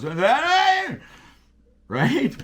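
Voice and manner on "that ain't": anxious. Retold or imitated. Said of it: "anxiousness in the voice when a guy goes".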